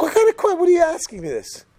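very high, exasperated outburst; high-pitched irritated dad; indignant father. Acted. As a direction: burst out in mock outrage at the question. Father acted as irritated by question.

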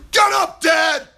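mock scream. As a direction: bark it like a demanding son. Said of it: "like Esav screamed at his father".

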